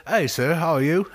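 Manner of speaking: put-on English accent. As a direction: greet the customer in a hammed-up English accent. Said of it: "When do I go back to regular voice?".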